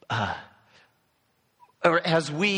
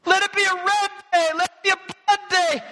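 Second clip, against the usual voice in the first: very high, loud, fast battle rally; a king firing up his riders; king's war speech; 5 dB louder, faster than usual. Retold or imitated. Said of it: "he gives an inspiring speech".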